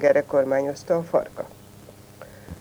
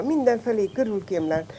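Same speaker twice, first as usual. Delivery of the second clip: ordinary delivery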